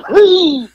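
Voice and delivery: high, loud squeal of escaping air; air knocked out. Retold or imitated. Telling how his opponent got landed on, winded squeal. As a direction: imitate a man's wind being crushed out. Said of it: "the air coming out of him".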